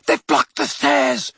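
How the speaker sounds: shouted spell. A narrator's reading. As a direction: call out across the fight. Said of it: "a voice shouted".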